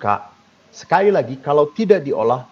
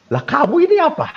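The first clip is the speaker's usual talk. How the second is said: incredulous retort; 13 semitones higher, faster than usual. Retold or imitated. Priest cutting in on the girl, incredulous and high. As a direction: pitch jumps high in disbelief.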